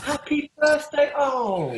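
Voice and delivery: high, loud, drawn-out birthday cheer; delighted congratulation. Real, not acted. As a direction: gush it slowly to a dear cousin. Learns it is cousin's birthday.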